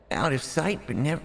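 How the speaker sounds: high, half-hearted cute character voice; Pio-chan voice. Acted. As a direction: try a cute anime girl voice, then give up. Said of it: "I can't do a Pio-chan".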